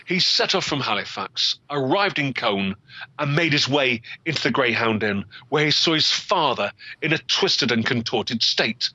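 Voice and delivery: plain reading voice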